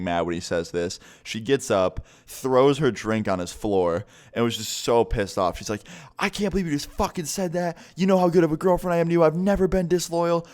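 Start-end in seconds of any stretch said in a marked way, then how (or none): 6.2-10.5 indignant retold tirade pitched way up; mock screaming. Girlfriend screaming in his face, mock screaming. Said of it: "just screaming in his face".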